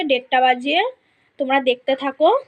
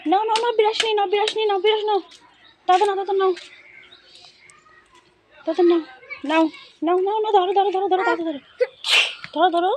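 coaxing; 6 semitones higher, faster than usual. Real, not acted. Coaxing son to take brush.

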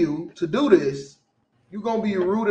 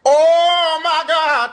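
slow, drawn-out disbelief, higher and louder; exasperated oh my God; 7 semitones higher, 7 dB louder, much slower than usual. Real, not acted. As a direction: stretch it out in disbelief. Exasperated reaction.

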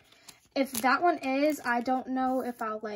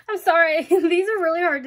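loud, higher, through laughter; laughing apology; 5 semitones higher, 10 dB louder than usual. Real, not acted. Vlogger struggling to see the photos, laughing apology. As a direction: apologize while cracking up.

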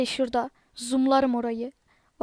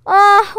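frustrated groan; 5 semitones higher, 11 dB louder than usual. Real, not acted. Ölümden sonra hayıflanıyor.

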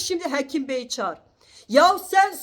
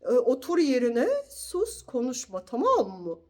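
softer, lower prissy mock of the prosecutor; mocking gentle prosecutor; 4 semitones lower, 5 dB quieter than usual. Complaining to the doctor by mimicking the prosecutor, softer and lower. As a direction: mimic him dainty and limp. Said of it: "Nazik nazik konuşuyor".

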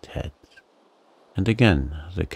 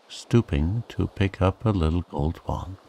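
plain reading voice

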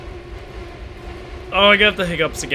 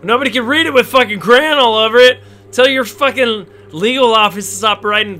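exasperated shout; 6 semitones higher, 6 dB louder than usual. Real, not acted. Exasperated yell at game.

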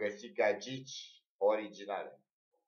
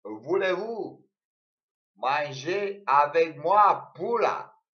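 mock French; 6 semitones higher, 13 dB louder, slower than usual. Mock French pickup line.

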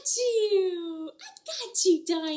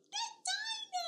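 very high soft squeal; excited squeal; 9 dB quieter than usual. Acted. Teacher squealing when the hiding dino pops out. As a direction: squeal with delight.

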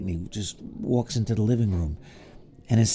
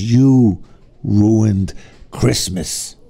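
furious aunt; 3 semitones lower, 6 dB louder, much slower than usual. Acted. Furious aunt scolds the boy.

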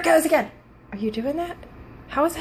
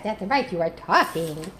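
ordinary talk, nothing marked

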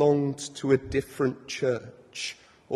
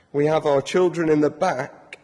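ordinary delivery

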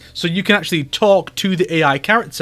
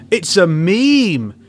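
slower and louder, pressing the point home; louder, insistent; 2 semitones higher, 5 dB louder, much slower than usual. Shown as a demonstration. Telling Homelander his milk pose is a meme, louder insistence. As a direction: drive it in, slowly and loud. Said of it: "repeats the line louder".

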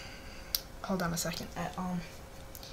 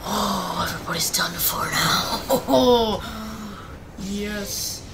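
gloating laughter; 3 semitones lower, slower than usual. Real, not acted. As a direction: lower words breaking into a laugh. Gloats over powerful hero.

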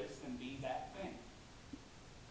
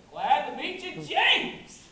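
loud, high, rising welcome; big exuberant hello, climaxing on the name; hearty greeting; 9 semitones higher, 11 dB louder, slower than usual. Acted. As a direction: boom the greeting out with gusto.

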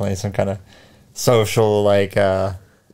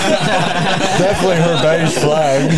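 high, slow laughing line; 7 semitones higher, slower than usual. Real, not acted. Laughing at friend's story.

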